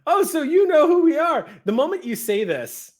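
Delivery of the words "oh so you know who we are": high, loud mock echo; exaggerated high-pitched imitation; mimicking the player. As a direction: parrot the line back, pitched way up. Game master mimics the player's line.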